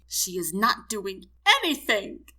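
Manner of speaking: drawn-out, slow, distraught complaint; wailing. A narrator's reading. Wife interrupts the medic to complain, wailing. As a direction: wail it out in protest. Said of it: "Missus Harrison wails".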